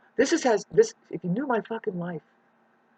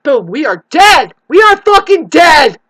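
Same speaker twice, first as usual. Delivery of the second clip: furious shout; 11 semitones higher, 18 dB louder than usual. Real, not acted. Ranting vlogger warns everyone they are dead, louder shout. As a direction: shout it, much louder and higher.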